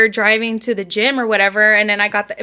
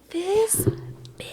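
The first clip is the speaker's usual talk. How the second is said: hushed whisper of disbelief; whispered outrage; 8 semitones higher, 9 dB quieter than usual. Retold or imitated. Client reacting to therapist's false note, whispered outrage. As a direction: whisper it, as if stunned by her nerve.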